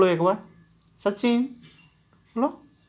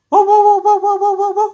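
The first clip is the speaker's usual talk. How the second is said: barking; 11 semitones higher, 7 dB louder than usual. Shown as a demonstration. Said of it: "और real, और real करो।".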